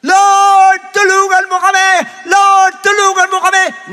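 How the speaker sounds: high, loud cry for help; acted desperate shouting. Drowning people beg the Lord for help, acted shouting.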